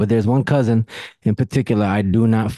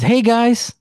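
much higher, sugary cousin greeting; fake-friendly cousin; 13 semitones higher than usual. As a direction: mimic the cousin's overly sweet hello. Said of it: "and says".

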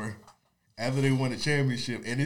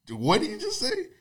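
surprised; 14 semitones higher than usual. Retold or imitated. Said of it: "So people like".

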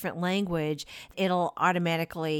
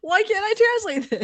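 mock panic; 12 semitones higher, 4 dB louder than usual. Mock panic over translation.

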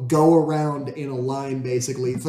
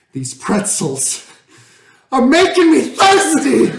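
sudden shrieking burst, much higher and louder; wild yell; 10 semitones higher, 8 dB louder, slower than usual. Retold or imitated. George blurts the line at a party in a wild high yell. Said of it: "And he just goes".

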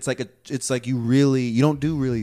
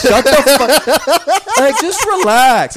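laughing; 10 semitones higher, 8 dB louder, much slower than usual. Real, not acted. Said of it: "I fucking hated Peggy Hill".

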